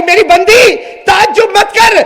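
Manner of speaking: high, loud commanding divine voice; voice of God. Acted. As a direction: proclaim from above. Voice of God speaks. (بلند الٰہی آواز)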